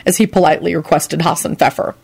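usual speaking voice

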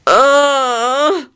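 high drawn-out groan; moan. A narrator's reading. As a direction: moan in discomfort. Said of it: "Neville moaned".

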